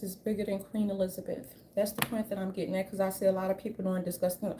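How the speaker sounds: ordinary talk, nothing marked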